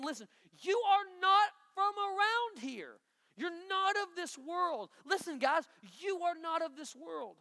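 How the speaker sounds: high, slow, with a catch of crying; a pastor's voice breaking as he voices Paul; tearful plea. Acted. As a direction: plead it near tears. Emotional voicing of Paul.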